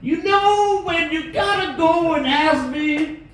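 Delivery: high, slow, drawn-out Cosby voice; Bill Cosby. Pretend. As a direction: stretch every word like a cartoon Cosby. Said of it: "Bill Cosby".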